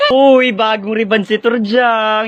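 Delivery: drawn-out, very loud call; loud announcement. Acted. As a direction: blare out the gossip slowly for all to hear. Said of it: "don't you dare loudly speak".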